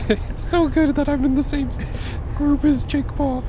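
very high feminine put-on voice; slow, high diva voice. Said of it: "what are you, J-Lo?".

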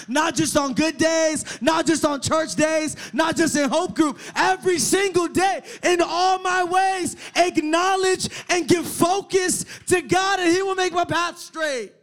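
loud, fervent preacher's shout; preaching. Acted. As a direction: preach it out loud, slow on the "not just" lines. Preaching at full force.

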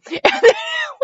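high-pitched burst of laughter; laughing. Real, not acted. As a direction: crack up helplessly. Laughs at the dialogue tag.

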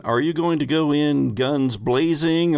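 ordinary talk, nothing marked

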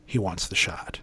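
understated doctor voice, barely projected; low, quiet doctor. Retold or imitated. Doctor's curt order to nurses.

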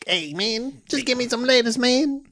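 high, whiny worm voice; a laid-back little worm begging; squishy wobbly worm. Pretend. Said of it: "mine would for sure be like".

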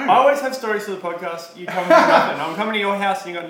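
loud mock-indignant laugh; teasing outrage through laughter; laughing complaint. Real, not acted.

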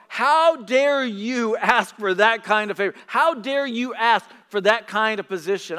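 loud, slow, indignant demand; mock-indignant rebuke, loud and slow; indignant outcry. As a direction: throw the disciples' outrage back at them. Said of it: "they were indignant".

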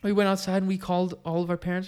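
ordinary delivery

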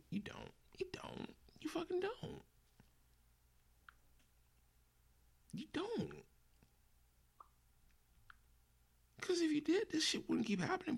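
high, strained falsetto rant; exasperated squeak. Real, not acted. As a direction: voice cracking up high in frustration. Ranting at the studio.

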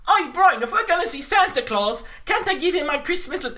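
very high, daft cartoon mouse voice; Pinky. Dim mouse begging his partner about Santa, high-pitched impression. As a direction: plead in a silly, squealing cartoon voice. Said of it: "this is Pinky's voice".

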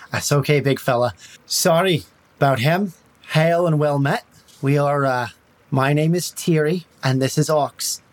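light Irish lilt, friendly and smooth; a hint of an Irish traveller calming a guard; Irish accent. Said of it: "Now you're making me Irish".

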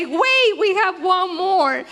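calling out to the pastor before baptisms end; retold call across room. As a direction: shout to stop them from the back of the room. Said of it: "going to scream from the back".

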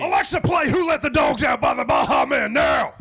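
high-pitched yell at a smart speaker; mock furious command. User losing it with Alexa, mock furious. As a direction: escalate into a hammed-up shout on the last word.

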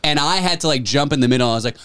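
usual speaking voice

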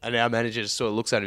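usual speaking voice

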